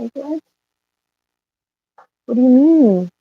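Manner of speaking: high, loud outraged disbelief; can't believe what she's hearing; incredulous. Real, not acted.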